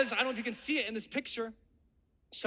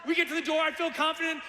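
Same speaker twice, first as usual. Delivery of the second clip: ordinary delivery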